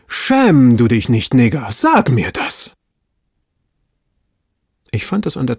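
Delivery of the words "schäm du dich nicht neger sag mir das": loud, drawn-out exclamation in character; slow, loud self-reproach; loud self-scolding. A narrator's reading. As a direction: scold yourself at full voice, stretching the words. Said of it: "rief er aus, als sei er in einem Selbstgespräch".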